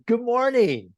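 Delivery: high, bright-spirited cheery hello; cheery greeting. Retold or imitated. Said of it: "I give him a cheery".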